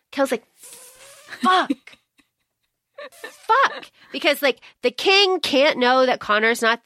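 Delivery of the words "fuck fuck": laughing fuck. Retold or imitated. Kel cornered, laughing retelling.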